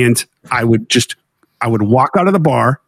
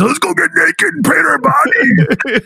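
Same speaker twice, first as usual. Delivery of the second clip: high, slow mock rallying call; slow, high mock call to get naked; mock excited; 13 semitones higher, slower than usual. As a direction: hype them up with a hammed-up cheer. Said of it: "I'm like".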